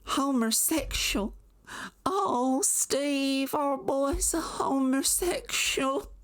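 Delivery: high, slow gasping disbelief; mock shocked mom. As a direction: clutch your pearls as the mother. Mom reacts to letter.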